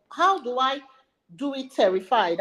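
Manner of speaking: ordinary talk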